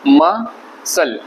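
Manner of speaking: मसल split as ma, pause, sal; very slow, separated मसल; syllable by syllable. Shown as a demonstration. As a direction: break मसल into two parts, c unsounded. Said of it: "आप इसको ऐसे पढ़ते हैं".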